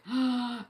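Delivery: sharp audible intake of breath; dramatic gasp. Acted. Reader reveals the mask has slipped, dramatic gasp. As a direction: gasp as if shocked. Mimes shock as mask slips.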